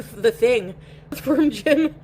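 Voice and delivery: ordinary talk, nothing marked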